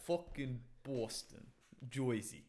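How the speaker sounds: put-on East Coast American accent, drawn out; Boston-Jersey accent. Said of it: "a Boston accent".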